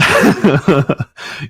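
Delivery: a loud, bursting laugh; hearty laugh. Real, not acted. Laughing loudly at host's joke about four hours. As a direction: let a big real laugh out.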